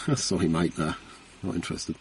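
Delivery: soft, low, dismissive brush-off that fades to a whisper; a hint of a bored householder, trailing off; imitating a householder. Householder turning away a door-to-door seller, mild brush-off.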